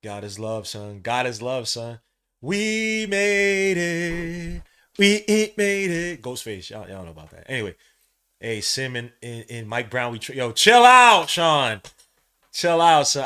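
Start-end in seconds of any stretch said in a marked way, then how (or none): 2.4-6.2 drawn-out triumphant cry. Real, not acted. Celebrating the clinch. 10.3-13.2 loud laughing scold. Real, not acted. Telling a hyped friend to calm down.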